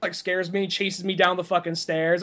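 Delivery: ordinary talk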